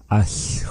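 Us said "ash-hwoo", ending in airy friction; wind-like sound. Shown as a demonstration. Teacher breaking down the root word, Us with a windy hissed ending. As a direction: let Us trail into a whistling "hw" like wind through a crack. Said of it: "like east wind blowing through the crack".

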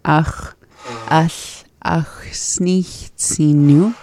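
very slow, word-by-word demonstration. Shown as a demonstration. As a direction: draw it out slowly for a learner, pausing between words. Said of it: "So I'm gonna say that slower".

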